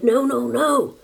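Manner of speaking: high, mildly pleading protest; anxious refusal. A narrator's reading. Martina shakes her head at grandmother, anxious refusal. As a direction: protest in a lifted voice.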